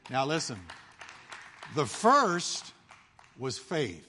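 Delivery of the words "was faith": ordinary delivery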